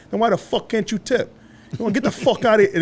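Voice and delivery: hammed-up kick-out; angry owner. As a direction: owner ordering the table to leave. Owner throws them out.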